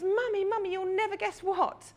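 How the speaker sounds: put-on high, eager child's voice bubbling with news; breathless little girl sharing big news; excited little girl. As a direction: burst in like a proud eight-year-old. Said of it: "and she says".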